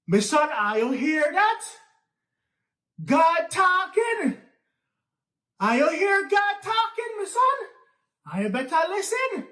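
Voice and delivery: convince them God is talking; awed preacher. Acted. Nancy frightening the King's men, high awed preaching.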